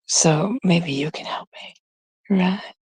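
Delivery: hushed conspiratorial whisper; confiding whisper to the viewers. Real, not acted. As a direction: lean in and whisper it like a secret.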